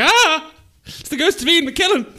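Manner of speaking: high startled yelp; startled cry. Retold or imitated. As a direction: jump back in fright. Acting out a startled scream.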